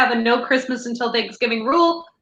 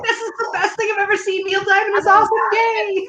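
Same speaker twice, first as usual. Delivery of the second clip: high-pitched gushing fangirl, half laughing; teenage fangirl; 8 semitones higher than usual. Retold or imitated. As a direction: gush like an excited teenage fan, pitched up. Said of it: "I was, like, a teenage fangirl".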